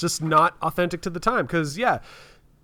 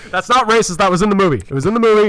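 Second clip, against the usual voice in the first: loud defensive protest; 4 semitones higher, 5 dB louder, faster than usual. Real, not acted. Defending a joke, laughing.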